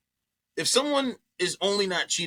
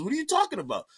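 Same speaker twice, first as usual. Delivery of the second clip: mock innocent denial; 11 semitones higher, much faster than usual. Friend's fake innocence voiced.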